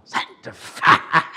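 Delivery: slow whispered exclamation; mock exclamation. Real, not acted. Mocking his old self, whispered exclamation. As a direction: hiss the word for comic effect. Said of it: "repeating his own word".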